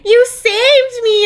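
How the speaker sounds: very high, drawn-out gushing; mock swooning heroine. Friend mocking heroine saved from the sea, swooning gratitude. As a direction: gush like a rescued damsel, hammed-up.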